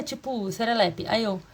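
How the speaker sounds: ordinary talk, nothing marked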